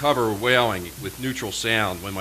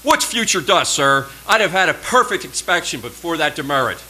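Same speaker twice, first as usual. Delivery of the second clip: loud, higher, indignant cadet; fired-back protest; 7 semitones higher, 7 dB louder than usual. Retold or imitated. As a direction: shoot the question straight at the officer. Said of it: "I fired it right at him".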